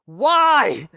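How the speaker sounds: high, loud, pressing demand; loud insistent why. Acted. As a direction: fire the question up high. Questioner's last, sharpest demand.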